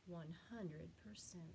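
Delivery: whispered, seasick and weak. Acted. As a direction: breathe it out queasily. Seasick whisper at line end.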